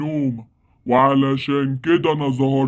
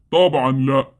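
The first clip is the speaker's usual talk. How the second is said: normal talking voice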